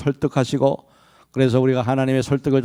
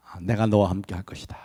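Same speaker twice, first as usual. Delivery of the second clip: gentle reassurance; 4 semitones lower, 6 dB quieter than usual. Acted. God voiced reassuring Moses.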